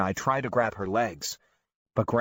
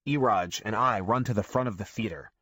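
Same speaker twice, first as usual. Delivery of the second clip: usual speaking voice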